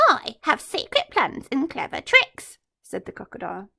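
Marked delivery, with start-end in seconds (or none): 0.1-2.6 sly boast. Acted. Crocodile boasting to elephant.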